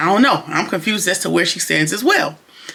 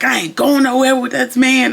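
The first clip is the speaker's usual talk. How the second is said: imitating an irate refusal, a touch higher; Gary getting irate at Hudson, mock irate; 6 semitones higher than usual. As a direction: put on a mildly irate voice. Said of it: "he starts getting irate".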